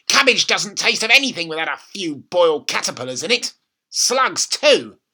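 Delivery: grandma gloating about caterpillars; bossy grandma. A narrator's reading. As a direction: lay down the law, relishing it. Grandma relishing.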